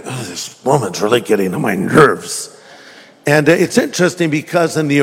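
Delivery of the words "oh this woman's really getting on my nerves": low, muted grumble of irritation; exasperated aside, pitched way down; weary annoyance. Acted. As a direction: grumble it under your breath, fed up. Voices weary apostles, annoyed.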